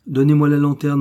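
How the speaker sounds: a master loudly insisting on the lantern; insistent command. Acted. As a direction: press the order home, no arguing. Repeats the order, insisting.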